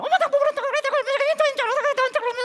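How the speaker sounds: high, loud mock teen talking back, fast; a teenage daughter yelling back at her mother, mock whine; whiny daughter. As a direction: snap back like a sulky teen. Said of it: "딸이 뭐라 그래요?".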